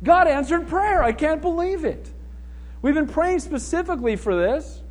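high, mock-amazed exclamation; astonished. Praying believers opening the door to Peter, astonished. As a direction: act stunned, pitch lifted right up. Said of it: "they were astonished".

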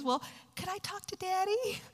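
small, high, soft voice; retold daughter. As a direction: ask timidly like a little girl. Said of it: "So Jenna says".

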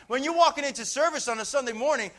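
ordinary talk

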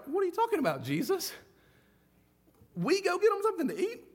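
can he be serious?; incredulous disciples. Retold or imitated. Disciples protesting to Jesus, high and incredulous. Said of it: "you can imagine they're like".

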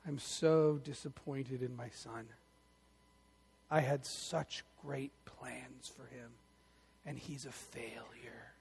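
low, soft and slow, fading to a whisper; defeated father muttering as he hangs his head; dejected dad. Retold or imitated. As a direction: let it sink with shame and disappointment. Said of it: "he just hangs his head".

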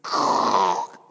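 loud, low croaking creak; harsh creak made by mouth; imitated creaking noise. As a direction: imitate a stiff book cracking open. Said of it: "I opened it up, and it went".

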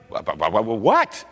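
low stammer rising into a question; acted bewilderment. Preacher caught off guard by the caller, mock stammering. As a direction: stumble over the word, baffled.

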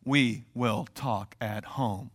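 low, soft and slow ominous warning; dignified parent warning a child in public; stern parent warning. Retold or imitated. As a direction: quietly threaten like a dignified parent. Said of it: "gave you that look and then they said".

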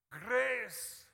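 "Grace!" shouted high and loud, falling. Shown as a demonstration. Preacher proclaiming the word to the congregation, a loud high shout.

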